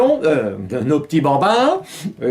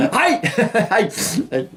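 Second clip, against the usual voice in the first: ouch; 7 semitones higher, slower than usual. Real, not acted. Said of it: "je me suis pincé la jambe".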